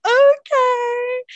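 a high, loud, giddy squealed okay; excited squeal. Retold or imitated. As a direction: squeal it like you can't believe your luck. Agreeing when he asks to visit.

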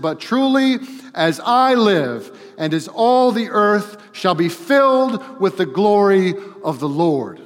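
high, loud, slow proclamation; each phrase driven home at full force; emphatic divine declaration. Acted. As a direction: thunder it out with vitality and emphasis. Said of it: "he said it with this such vitality and emphasis".

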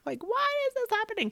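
high, fast mock wail; exasperated wail. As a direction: act out pained disbelief in a squeaky rush. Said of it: "And I'm like".

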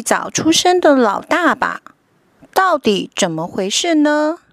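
put-on lively storybook narration for kids; reading to a toddler. A narrator's reading. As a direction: read it like a bedtime picture book. Picture book read to children.

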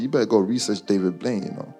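ordinary talk

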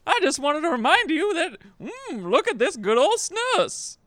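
Gary character voice. Acted. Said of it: "we got a character from [name] that's an original".

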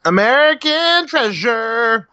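high, drawn-out exclamation; mock-grand proclamation. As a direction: proclaim it slowly like a grand title. Interjects a grand title for Cruise.

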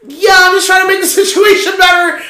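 high, loud, laughing mock protest; flustered protest. Guest to girlfriend, trying to help, flustered. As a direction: re-enact flustered over-explaining at high pitch. Guest defends own awkward reassurance.

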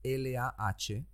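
slow letter-by-letter spelling. Shown as a demonstration. Host spelling the English transliteration for guest, slow and separate. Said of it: "lo escribirás como".

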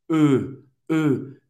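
E said with lips rounded as for O. Shown as a demonstration. Teacher drills the rounded French E. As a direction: say E with O-shaped lips. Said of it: "fazer a boca do O e falar E".

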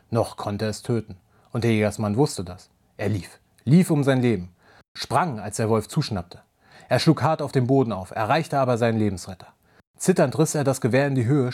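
low, measured storyteller voice; story narration. A narrator's reading. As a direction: read it like a dark fairy tale. Reads a werewolf story aloud.

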